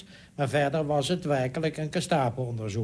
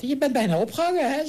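imitating a much higher, louder voice; 14 semitones higher, 4 dB louder than usual. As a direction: squeak it up like the minister's high voice. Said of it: "die heeft met zijn hoge stem verteld".

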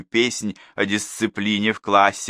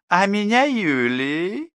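higher, slow, smiling woman's voice; smiling reply; 12 semitones higher, slower than usual. A narrator's reading. Yulia tells Danilov her name with a smile. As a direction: let the smile be heard in the name. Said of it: "снова улыбнулась соседка".

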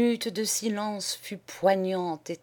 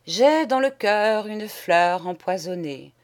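ordinary delivery

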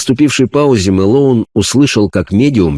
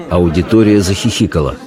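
ordinary delivery, nothing marked

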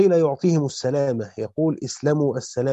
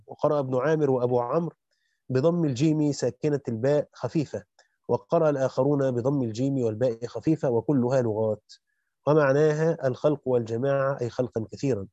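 usual speaking voice

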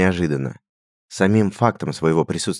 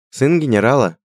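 shrugging; 8 semitones higher, 5 dB louder than usual. A narrator's reading. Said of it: "пожал плечами Радгар".

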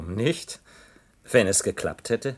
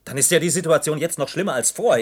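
higher and louder, alarmed and upset; panicking minister losing his composure; agitated outburst; 7 semitones higher, 6 dB louder than usual. Acted. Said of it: "Beruhigen Sie sich".

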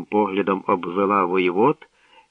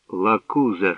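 usual speaking voice

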